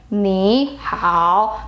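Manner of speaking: 你好 with both syllables held low and dipping; two third tones. Shown as a demonstration. Teacher shows what you originally should say, two low tones. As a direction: say 你好 slowly low on each syllable, separately. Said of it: "originally you should say".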